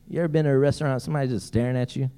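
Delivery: ordinary talk, nothing marked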